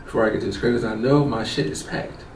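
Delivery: low, very soft muttering; self-talk. Retold or imitated. Man steadying himself before reaching home, low hushed self-talk. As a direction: act out privately bracing yourself.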